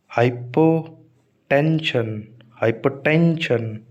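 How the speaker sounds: Hypotension slowly, in parts, weight on टेन; stress on टेंशन's first syllable. Shown as a demonstration. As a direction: draw out TEN in hypotension. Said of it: "तो शन से just". (Hypotension के टेन पर ज़ोर, धीरे)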